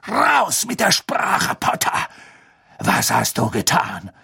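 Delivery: high, loud, slow furious snarl; Snape beside himself. A narrator's reading. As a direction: spit out each word in a rage at Potter. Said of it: "Doch Snape war außer sich".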